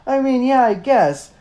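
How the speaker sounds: high, loud, laughing exasperation; sarcastic friend cracking up mid-rant; exasperated Marco. Retold or imitated. Marco to Cassie, high exasperated laughing sarcasm. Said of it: "And Marco's like".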